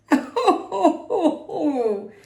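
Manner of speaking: big high burst of laughter; loud laugh. Real, not acted. Tarot reader reacting to the drawn card, laughing out loud. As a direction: crack up at the card.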